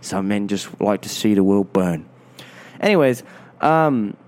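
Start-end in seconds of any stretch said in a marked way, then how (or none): 0.0-2.1 low grave English butler; weary low Cockney wisdom; Michael Caine as Alfred. Pretend. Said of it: "like Michael Caine".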